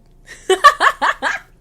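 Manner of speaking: high, loud cackle of laughter; loud laughing fit. Real, not acted. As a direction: cackle uncontrollably. Bursts out laughing.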